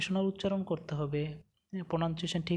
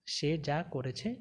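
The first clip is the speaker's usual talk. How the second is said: normal talking voice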